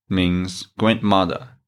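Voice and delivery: normal talking voice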